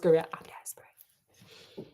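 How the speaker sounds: slow whispered mocking confession. Real, not acted. Host repeating the heroine's line in a slow whisper. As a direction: drag it out in a whisper.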